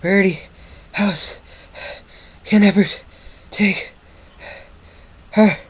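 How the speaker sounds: high, breathless gasps between single words; out of breath, pausing after each word; panting. A narrator's reading. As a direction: pant it out one word at a time. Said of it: "he panted".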